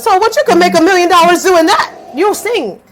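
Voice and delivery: high, loud scoffing boss; mocking boss. Boss scoffing at her tarot income, high loud mock.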